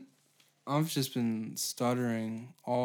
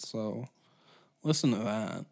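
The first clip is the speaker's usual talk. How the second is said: normal talking voice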